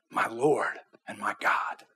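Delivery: breathless whispered confession; awed whisper. Acted. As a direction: whisper it in awe. Thomas awed before risen Jesus.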